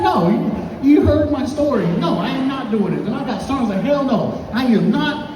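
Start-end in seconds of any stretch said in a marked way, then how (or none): 0.0-3.0 high-pitched comic refusal; mock indignant protest. Acts protest to the professor. 3.8-5.3 higher-pitched comic indignation; mock adamant refusal. Acts refusal to the professor.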